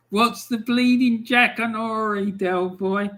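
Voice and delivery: put-on high-pitched, not very good Dublin accent; attempted Dublin accent. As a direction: have a rough go at a Dublin accent. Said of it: "I can't do a good Dublin accent".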